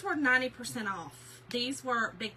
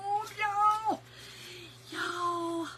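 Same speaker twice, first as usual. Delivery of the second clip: laughing near tears; 8 semitones higher, much slower than usual. Real, not acted. Emotional over florals.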